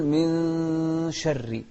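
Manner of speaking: "من شر" with the nun held as a long nasal hum before the sheen; hidden nun. Shown as a demonstration. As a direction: stretch the nun of "من شر" through the nose, slowly. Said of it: "يعني نخفي النون". (إخفاء النون في "من شر" بغنة ممدودة)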